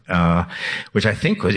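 usual speaking voice

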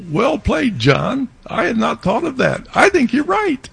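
high lightly voiced AI praise; imitating his AI. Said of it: "Alan says".

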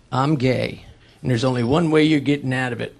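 low, soft mock sergeant; lightly voiced sergeant, low and quiet. Sergeant to a scared recruit, low-voiced ultimatum. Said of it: "And he said to me".

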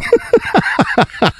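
high-pitched peals of laughter. Real, not acted. Cohost after fooling the host, high laughing.

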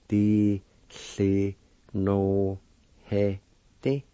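Di, si, no, he, di said apart; syllable by syllable. Shown as a demonstration. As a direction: tick off each syllable. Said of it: "First part is pronounced like this".

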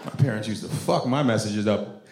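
ordinary delivery

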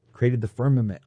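ordinary delivery

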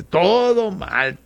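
drawn-out, exaggerated complaint; slow, hammed-up grumble; mock lament. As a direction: stretch the words in comic dismay. Mock lament over local teams losing.

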